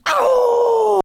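very high mock wail of shock; hammed-up shocked gasp. Offended driver squealing in mock shock. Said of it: "they're like".

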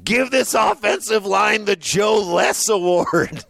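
high, slow, hammed-up impression of a co-host; Jason. Impersonating the absent co-host's hottest take, hammed-up high voice. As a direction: put on a high, drawn-out hot-take voice. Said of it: "do my best Jason impression".

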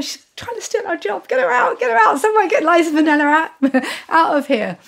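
high, urgent comic protest; mock panic. Dancers wanting the star thrown out, mock panic. Retold panic over Liza taking job.

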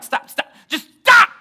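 building to a high shout; exasperated stopping. Retold or imitated. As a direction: cut in, then yell the last stop. Husband cuts off panicking wife.

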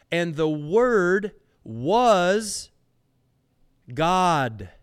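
slow and loud, stretch "Word was" then pause before "God"; emphatic proclamation. Real, not acted. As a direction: build to "God" and land it. Climax of the verse.

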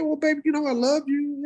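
high-pitched mom reassurance; imitating unsure mom. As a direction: imitate a mother fumbling for a kind reply. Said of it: "didn't probably know how to respond".

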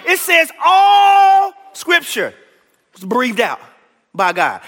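"all" hit high, loud and stretched out; preaching stress on all. Acted. Preacher to his class, stretching and belting "all". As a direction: lean hard on "all", almost crying it out.

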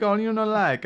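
high, loud mock Italian accent; exaggerated Italian host voice; mock Italian waiter. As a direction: play the pushy Italian waiter, hammed up. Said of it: "They're like".

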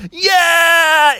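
high, drawn-out excited yell; cheering. Acted. Bucky cheering Froggy's return, high excited yell. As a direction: whoop for your friend.

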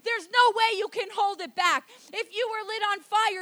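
loud, high, driving sermon delivery; preaching at full force. Real, not acted. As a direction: fire up the congregation at full volume.

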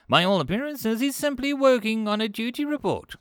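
high-pitched, fretful creature voice; agitated small alien. Acted. Five Clicks reports a giggling human to Kolch, agitated.

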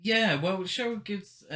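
normal talking voice

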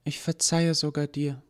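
forgive the professor sadly; high, gentle sad line; sad forgiveness. Acted. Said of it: "sagte traurig".

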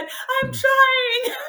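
high, strained and tearful; crying out. Retold or imitated. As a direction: squeeze it out through tears. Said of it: "And I said".

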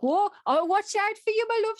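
high, loud Dudley neighbour; Dudley accent. Retold or imitated. Elderly neighbour promises to look out for author, Dudley accent. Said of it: "she spoke like me".

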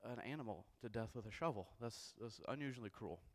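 soft, hesitant muttering under the breath with a sigh; muttering to himself. Retold or imitated. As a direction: mumble it to yourself, unsure and upset. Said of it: "You start muttering".

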